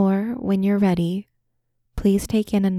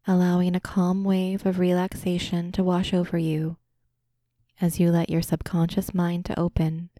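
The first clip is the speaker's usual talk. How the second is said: usual speaking voice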